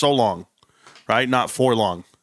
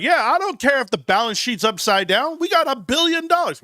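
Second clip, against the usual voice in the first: 1 excited bragging; 10 semitones higher than usual. Retold or imitated. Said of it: "I was like".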